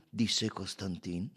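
plain reading voice

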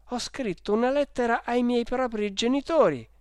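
put-on high, timid boyish voice; trembling reply. Boy confessing to the furious salesman, trembling. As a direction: answer in fear of the shouting man. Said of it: "ho risposto tremando".

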